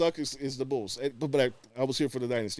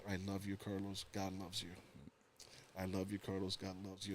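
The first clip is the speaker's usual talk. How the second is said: much softer, hushed re-enactment; retold pleading reassurance; 2 semitones lower, 13 dB quieter than usual. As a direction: repeat it softly, like consoling someone. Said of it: "started just telling me".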